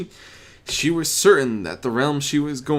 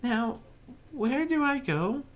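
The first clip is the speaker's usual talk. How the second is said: high and softer, timid pony; Fluttershy voice; 3 dB quieter than usual. Acted. Narrator voices Fluttershy enjoying the quiet gray realm. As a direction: murmur it gently, relieved.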